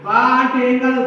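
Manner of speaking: ordinary talk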